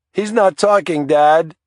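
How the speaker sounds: put-on a frightened child crying to his dad; boy bawling on the phone to his dad. A narrator's reading. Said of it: "through his bawling".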